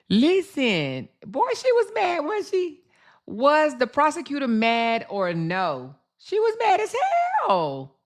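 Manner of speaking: high squealed disbelief at her anger; high-pitched gossip exclamation; exaggerated high mock outrage. Real, not acted. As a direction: pitch way up, teasing how mad she was. Mocking the angry prosecutor.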